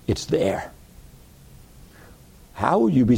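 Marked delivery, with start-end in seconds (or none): 0.1-0.7 hushed, breathy secret; whispered treasure hint. Acted.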